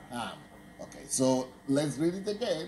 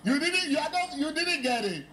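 very high, laughing shriek of triumph; real delighted cackle, pitched way up; gleeful laughing gotcha; 12 semitones higher than usual. Real, not acted. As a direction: crow it through big peals of laughter. Teacher gleefully springs trick on class.

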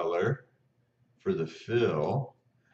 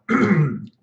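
quick rough throat clear; 10 semitones higher, 10 dB louder than usual. Real, not acted. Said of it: "excuse me".

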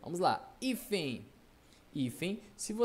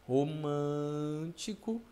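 ROMÂNTICO drawn out slowly, syllable by syllable; stretched syllables; much slower than usual. Shown as a demonstration. Teacher giving an example, romântico slowly drawn out. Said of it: "por exemplo".

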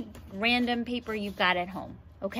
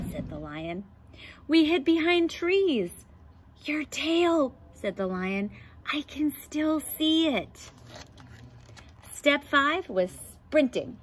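put-on lively picture book read-aloud for kids; reading to children; 3 dB louder than usual. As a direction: read it like storytime for the class. Reads picture book to class.

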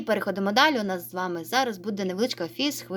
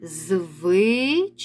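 slow syllables. Shown as a demonstration. Teacher to pupils, slowly sounding out Звичаї. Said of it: "Називаємо перше слово".

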